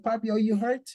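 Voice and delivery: a hint of a concerned little child; little son asking. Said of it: "Or he said".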